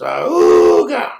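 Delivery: loud honking car-horn noise; Model T horn. Retold or imitated. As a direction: blast it out like an old car horn. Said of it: "like a Model T horn".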